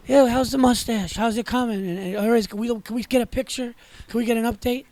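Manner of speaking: a hint of a pestering caller's Irish lilt; Irish accent. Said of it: "lady with this Ir-, Irish accent, really heavy".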